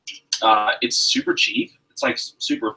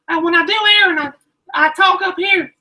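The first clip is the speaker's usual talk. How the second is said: much higher, squeaky imitation of mom; high-pitched mom imitation; 5 dB louder than usual. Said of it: "whenever I do your accent, it's really high".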